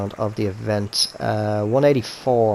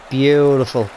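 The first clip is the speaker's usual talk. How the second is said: excited; 4 semitones higher, 7 dB louder, slower than usual. Real, not acted. Great approach shot.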